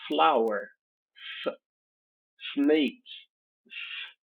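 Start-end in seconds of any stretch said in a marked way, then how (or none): 1.4-1.6 "F" as a short voiceless f sound; bare phoneme. Shown as a demonstration. Teacher picking out the sound in flower, bare phoneme. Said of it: "O fonema". 3.7-4.2 "S" as a soft voiceless hiss; bare phoneme. Shown as a demonstration. Teacher picking out the sound in snake, bare phoneme. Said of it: "O fonema".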